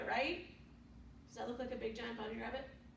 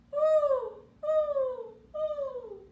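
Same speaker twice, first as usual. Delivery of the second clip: high loud owl hooting; 13 semitones higher, 11 dB louder, much slower than usual. Acted. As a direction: hoot like an owl. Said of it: "what does he say".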